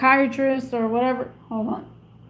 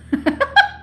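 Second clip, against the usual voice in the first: short high-pitched laugh; amused high giggle; 14 semitones higher than usual. Real, not acted. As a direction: break into a quick high laugh. Laughs at own remark.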